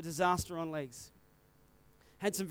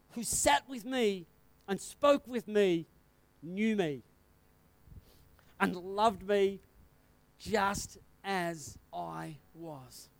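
tender, sincere; 2 semitones lower, much slower than usual. Real, not acted. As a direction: let each phrase land gently. Tender moment of being loved.

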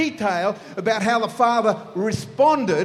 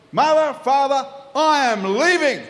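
higher, louder, grandly melodramatic declaration; operatic announcement; 6 semitones higher, 4 dB louder than usual. Acted. Son bursting into the lounge room, operatic declaration. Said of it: "It's kinda like an Italian opera".

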